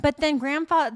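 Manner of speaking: normal talking voice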